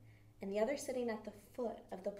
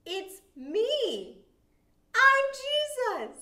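high, loud, slow joyful reveal; 12 dB louder, much slower than usual. Retold or imitated. Jesus reveals himself to Mary, high and joyful. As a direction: ring it out with delight. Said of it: "And he's like".

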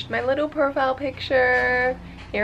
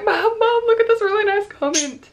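much higher, louder excited call; excited call to Mom; 11 semitones higher, 7 dB louder than usual. Retold or imitated. Retelling how she called her mother about the comment, thrilled. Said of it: "I was like".

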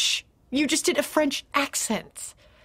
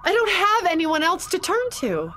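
higher, begging voice; cling to him with every word; desperate pleading; 6 semitones higher than usual. Acted. Wife pleading with her husband.

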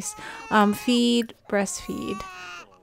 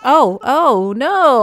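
loud, higher "oh, oh, no"; sudden loud dismay; surprised outcry; 4 semitones higher, 12 dB louder, slower than usual. Real, not acted. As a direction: caught off guard mid-goodbye. Notices mistake mid sign-off.

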